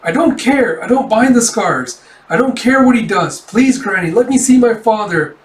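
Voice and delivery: put-on high, loud pleading child voice; acted childish begging, pitched right up; Gija as a small child. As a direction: plead like an insistent little kid. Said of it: "you see baby G-, Gija being like".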